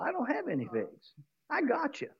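whisper-close gloating over the trick; hushed mocking tree; quiet taunt. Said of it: "the tree laughs at him and says".

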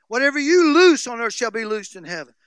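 usual speaking voice

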